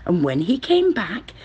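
ordinary delivery, nothing marked

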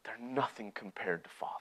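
dropped, soft conclusion; quiet sincerity. Acted. Younger brother telling what matters most, softly sincere. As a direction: let the voice fall to a hush.

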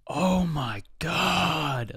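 high, very slow, drawn-out fed-up groan; mad exasperated groan. Retold or imitated. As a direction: stretch it out like an impatient shopper losing it. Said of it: "like, just mad".

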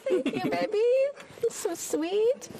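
words wobbling through real tears; crying softly. Real, not acted. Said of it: "Oh, I'm gonna cry".

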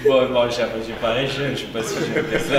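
laughing protest. Real, not acted. Guest laughing off the host's jab about his age. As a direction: brush off the teasing with a laugh.

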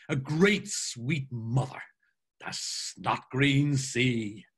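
slow, theatrical declamation; drawn-out, grand quoting voice; rhapsodic Mulligan. Acted. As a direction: savour each phrase with mock-poetic relish. Mulligan declaims about the sea.

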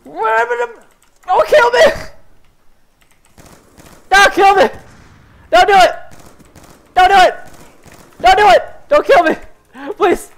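high, loud cries for mercy; panicked pleading. Real, not acted. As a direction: beg frantically, shouting in a high pitch. Begs enemies to spare him.

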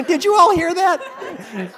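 high, slow laughing appeal; rally the room in mock shock; laughing mock outrage. Calling the class to witness, laughing and slow.

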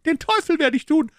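very high, loud maid's outburst; furious refusal. Acted. Maid refusing the detective, loud and very high. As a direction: spit out the refusal.

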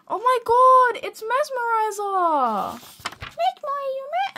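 high fangirl squeal, drawn out; excited squeal. Real, not acted. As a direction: squeal in delight, far above normal pitch. Squeals at a favourite drawing.

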